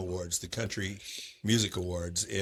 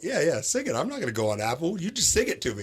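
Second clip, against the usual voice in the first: eager insistence; 7 semitones higher than usual. Retold or imitated. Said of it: "And I'm like".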